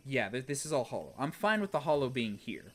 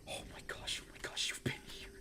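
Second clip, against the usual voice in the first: breathless hushed oh my gosh; awed whisper at the screen; stunned whisper; 10 dB quieter, faster than usual. Real, not acted. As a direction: whisper it like you just found a secret.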